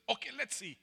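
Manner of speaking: high, loud sneering sister; mock him in a high jeering voice. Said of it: "She says".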